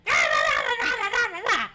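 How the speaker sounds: very high, loud shrieked imitation; over-the-top mimicked freak-out; mock hysteria. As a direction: screech it as a hammed-up panic, then babble. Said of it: "all I thought of was Bobby Mackey's".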